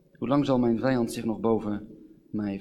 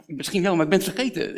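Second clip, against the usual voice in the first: higher, louder mock defensiveness; acted, exasperated excuse; flustered admission; 6 semitones higher, 5 dB louder than usual. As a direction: protest as the one who forgot. Plays forgetful person being blamed.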